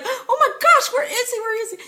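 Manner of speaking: high-pitched, hammed-up alarm, loud start then easing; reenacted panic. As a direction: act out waking up frantic over someone missing. Reenacts waking to find husband missing.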